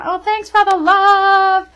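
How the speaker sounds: high, slow delighted thank-you; gushing thanks. Real, not acted. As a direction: gush thanks at the chat, drawn out. Thanking viewers for hearts.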